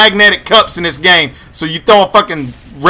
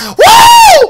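a high, loud whoop; 6 dB louder than usual. Real, not acted. Gamer hyping viewers with a high, loud whoop. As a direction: whoop it up like a revival crowd.

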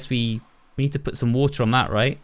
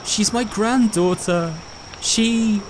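lightly voiced grieving grandfather, higher and slow; grieving old man; 9 semitones higher, much slower than usual. [name] telling of his granddaughter, lightly voiced grief. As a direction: read it slowly as a weeping grandfather. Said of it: "and he's sobbing".

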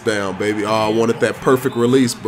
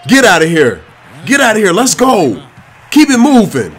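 excited yelling; 6 semitones higher, 7 dB louder than usual. Real, not acted. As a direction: shout it out loud and higher. Yelling at a blocked shot.

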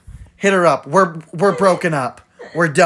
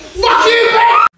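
screamed high and loud; furious shout; 6 dB louder, slower than usual. Real, not acted. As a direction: yell it in a startled rage. A startled, furious reaction.

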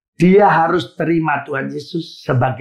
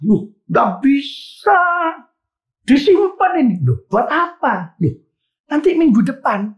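higher, insistent retold pastor's voice; stubborn church shepherd; 7 semitones higher than usual.

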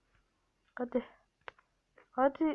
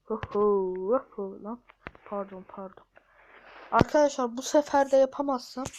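normal talking voice

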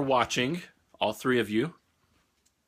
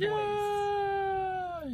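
excited cheer. Real, not acted. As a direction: cheer like the news is the best ever. Cheering the boys' weaning news.

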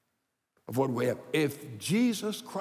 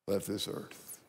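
hushed grief; 8 semitones lower, 9 dB quieter than usual. Real, not acted. Grief over dead brother.

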